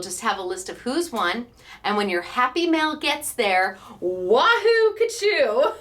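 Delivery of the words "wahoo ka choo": loud, high playful cheer; higher, louder and gleeful; celebratory whoop. Pretend. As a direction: whoop it like the prize just arrived. Imagines winners receiving happy mail.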